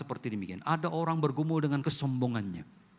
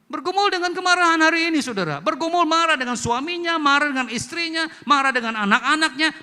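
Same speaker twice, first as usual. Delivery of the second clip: booming, much higher and louder preacher's cry; preaching at full force; 9 semitones higher, 8 dB louder than usual. Real, not acted. Preacher warns the congregation about anger, booming much higher and louder. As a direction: hammer home the point about anger.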